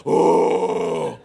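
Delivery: a hint of a deep manly groan; mock tough-guy oh; manly grunt. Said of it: "not talking about a manly".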